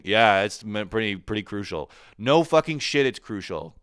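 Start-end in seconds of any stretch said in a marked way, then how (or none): 2.2-3.8 loud, high mock disbelief; sarcastic. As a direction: sneer at the obvious.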